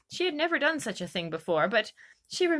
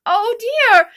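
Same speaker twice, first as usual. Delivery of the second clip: much higher, louder, slow oh dear; a sudden wail of dismay; Alice realising she offended the mouse, dismayed; dismayed cry; 13 semitones higher, 14 dB louder than usual. A narrator's reading. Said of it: "cried Alice in a sorrowful tone".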